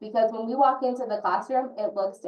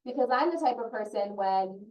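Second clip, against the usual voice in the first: normal talking voice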